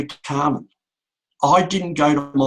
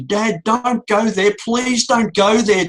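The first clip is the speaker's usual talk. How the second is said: higher, slow, desperate begging cry; retold pleading scream; 7 semitones higher, 3 dB louder, slower than usual. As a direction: stretch the words out as a pleading call. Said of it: "Michelle was screaming out".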